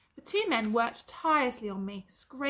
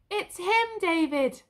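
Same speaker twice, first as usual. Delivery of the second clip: much higher and louder, overjoyed; joyful cry; 7 semitones higher, 6 dB louder, slower than usual. A narrator's reading. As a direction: burst out with joy at finding Joey. Albert recognises his lost horse.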